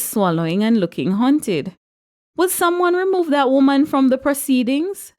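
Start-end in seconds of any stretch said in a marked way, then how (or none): 2.4-5.1 raised, flustered pastor's appeal; high-pitched, flustered request for order. A narrator's reading. Pastor to the wedding congregation, flustered. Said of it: "the flustered pastor said".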